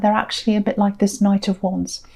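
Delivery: ordinary delivery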